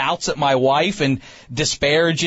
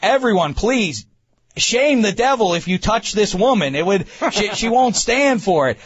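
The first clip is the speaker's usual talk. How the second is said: hammed-up public notice to passengers; mock cabin announcement; 6 semitones higher than usual. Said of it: "make an announcement to the plane".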